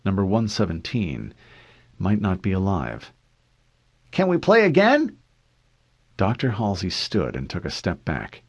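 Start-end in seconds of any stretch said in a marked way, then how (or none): 4.1-5.2 put-on high, loud little-boy voice; hopeful child's plea, pitched high; eager young boy. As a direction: ask like a kid keen for more. Boy begs another game.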